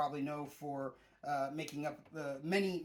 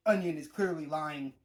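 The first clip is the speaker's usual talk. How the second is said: normal talking voice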